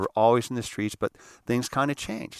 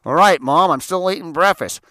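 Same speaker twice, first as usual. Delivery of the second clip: put-on high, loud kid's holler; son yelling back; 6 semitones higher, 8 dB louder than usual. Paul answering his mom during breakfast, imitated high yell. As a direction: shout back to Mom from another room.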